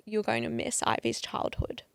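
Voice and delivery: whispered warning. Real, not acted. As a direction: breathe it as a secret message. Message from the trip.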